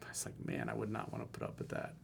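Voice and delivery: hushed aside. Real, not acted. Coach muttering his own view, hushed. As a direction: drop to a near whisper. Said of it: "quiet aside".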